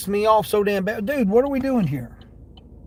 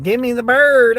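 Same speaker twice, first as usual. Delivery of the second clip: louder mock quote of the angry driver; 4 semitones higher, 7 dB louder than usual. Driver mocks the man who flipped him off, mock tough voice. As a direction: sneer it out like a tough guy. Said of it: "he's like".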